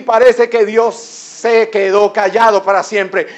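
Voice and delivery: loud, high, driving sermon climax; fervent shouted preaching; full-force preaching. Acted. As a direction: proclaim it at the top of your voice.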